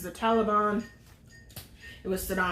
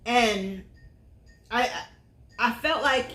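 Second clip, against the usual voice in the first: ordinary talk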